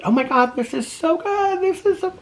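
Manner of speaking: squealing high with delight; retold excitement. Retelling the show's ending with a squeal of delight. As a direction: gush up high like you can't contain it. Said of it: "'cause I was so excited".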